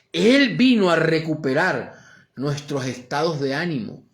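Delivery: loud, high, driving sermon voice; full-force preaching. Acted. Pastor preaching to the congregation, fervent and loud.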